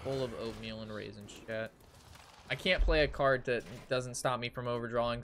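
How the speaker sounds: ordinary talk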